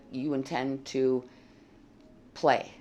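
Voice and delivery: ordinary delivery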